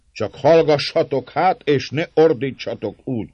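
derűs, hangos csitítás magas hangon; túlharsogva, de jókedvűen csitíts. A narrator's reading. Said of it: "folytatta Emez jókedve". (jókedvűen, emelt hangon)

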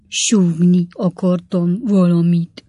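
loud, very slow character voice; laboured fat boy. A narrator's reading. Fat boy explaining to Pickwick, slow loud drawl. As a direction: drag out every word loudly.

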